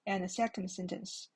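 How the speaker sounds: ordinary talk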